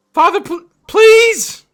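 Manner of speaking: high, loud panicked cry; desperate pleading shout. Acted. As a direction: beg frantically at the door. Desperate man pleading at door.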